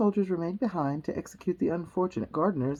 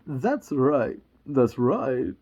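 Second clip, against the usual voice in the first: accidental impression, lower voice; Christopher Walken; 5 semitones lower, 5 dB louder, much slower than usual. Narrator accidentally drifting into an impression, lower-pitched. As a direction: slip into a low celebrity impression. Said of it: "let's Christopher Walken that one back".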